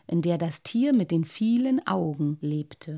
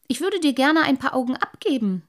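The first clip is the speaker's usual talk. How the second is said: higher, spiteful call after him; 6 semitones higher, 4 dB louder than usual. Acted. Lamprey jeering after the fleeing boy, spiteful. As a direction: jeer after him slyly. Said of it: "rief ihm das Neunauge hämisch hinterher".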